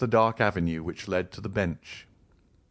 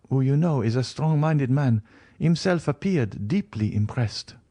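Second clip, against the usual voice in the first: put-on higher, worried young woman; young Valentine, troubled; 6 semitones higher than usual. A narrator's reading. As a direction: tell the doctor anxiously, higher. Valentine voiced in reading.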